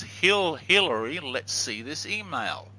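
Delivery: spelled out slowly and clearly; each word drawn out for listeners; slow clear demo. Shown as a demonstration. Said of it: "we run this in reverse, and he says".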